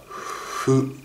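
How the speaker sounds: ふ breathed out softly with lips rounded, no teeth; soft voiceless Japanese ふ. Shown as a demonstration. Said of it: "日本語だったら、ふみたいな発音". (日本語の「ふ」をそっと息だけで)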